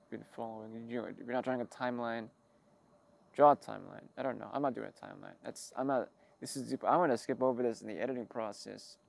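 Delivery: usual speaking voice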